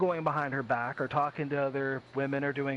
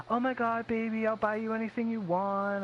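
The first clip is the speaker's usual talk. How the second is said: put-on eager, higher doting boyfriend voice; super loyal guy; 5 semitones higher than usual. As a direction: gush like a devoted pushover. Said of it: "that super loyal guy".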